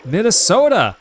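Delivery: loud, high Minnesota accent; exaggerated Minnesota accent. Shown as a demonstration. Streamer hamming up Minnesota for a viewer, exaggerated Minnesota accent. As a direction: belt it out in a hammed-up Minnesota accent. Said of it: "Oh, that accent's awful".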